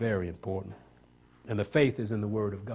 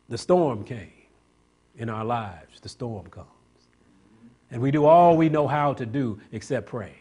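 usual speaking voice

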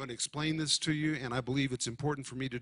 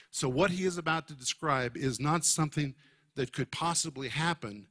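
normal talking voice

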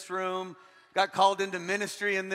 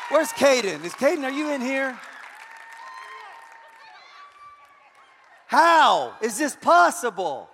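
loud, higher, delighted disbelief; surprised call-out; 8 semitones higher, 5 dB louder than usual. Real, not acted. Pastor calling out to the engaged young man, loud and amazed. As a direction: shout for him across the room, amazed.

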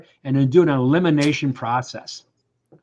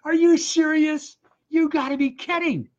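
incredulous; 12 semitones higher than usual. Retold or imitated. Son reacting to mom's dismissal, high and incredulous. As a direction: squeal out your disbelief. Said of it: "And I'm like".

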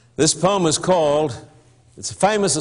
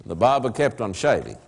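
low, softer dry refrain; 4 semitones lower, 3 dB quieter than usual. Acted. Narrator's refrain about the silent barber, low and soft. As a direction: drop it low and deadpan.

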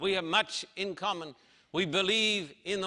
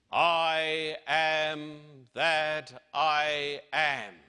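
divine name, drawn out; 2 semitones lower, much slower than usual. Acted. God naming himself to Moses, very slow and solemn. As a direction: stretch every word like an eternal name. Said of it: "And the one true God said".

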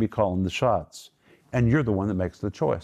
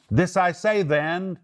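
"This I say then" spoken higher and slow, insistent, lightly acted; raised voice; 7 semitones higher, slower than usual. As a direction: lift "This I say then" high and slow so they listen. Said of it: "Paul's lifting his voice".

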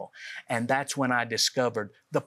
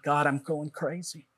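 desperate prayer. Retold or imitated. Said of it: "just screamed out to God".

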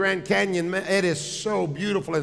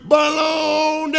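high, drawn-out holler; mock yell; 9 semitones higher, 4 dB louder, slower than usual. Wife yelling again to disprove the echo, high mock shout. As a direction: yell it long and high in disbelief. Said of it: "Yell again".

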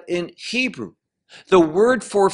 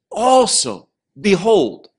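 "Also, behold" called out higher, with "Also" lifted and ringing; exclaimed; 5 semitones higher, 4 dB louder than usual. Shown as a demonstration. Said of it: "he is shouting out".

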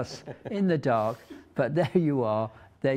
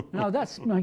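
normal talking voice

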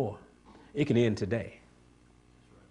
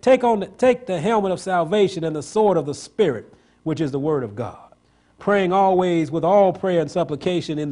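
rising to a high, loud preaching cry as Jesus; full-force preaching; 6 semitones higher, 8 dB louder than usual. Acted. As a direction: hammer it home like a revival preacher.

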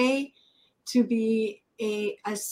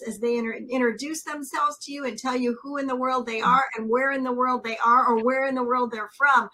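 ordinary talk

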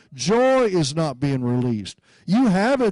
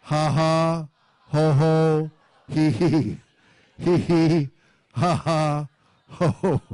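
spoken-out laugh syllables; deliberate laughing; slower than usual. Shown as a demonstration. Preacher showing how he laughs at problems, deliberate laughing. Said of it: "I laugh".

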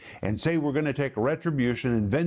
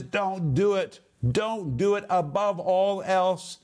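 higher, emphatic warning; forceful preacher's prohibition; 6 semitones higher than usual. Retold or imitated. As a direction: deliver DON'T DO IT as a firm prohibition, pitched up.

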